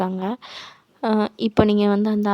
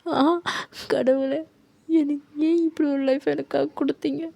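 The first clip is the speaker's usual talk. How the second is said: higher, rising despair, crying out to god; anguished lament; 5 semitones higher than usual. Acted. Orphaned girl cries out to god at the temple, anguished and rising. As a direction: break into a pleading wail at the end.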